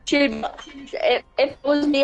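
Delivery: ordinary talk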